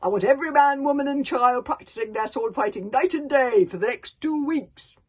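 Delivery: roaring father. Acted. Stoic silencing his son Hiccup with a roar. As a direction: roar him down. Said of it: "roared Stoic the Vast".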